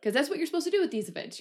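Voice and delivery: ordinary delivery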